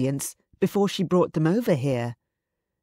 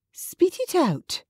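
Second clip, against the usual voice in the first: much higher, slow, insistent demand; slower than usual. A narrator's reading. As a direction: drag each word out, insisting on an answer. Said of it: "she demanded".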